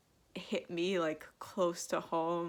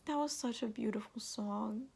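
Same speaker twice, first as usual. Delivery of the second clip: tearful whisper; 3 semitones higher, 7 dB quieter than usual. Real, not acted. Crying over a song.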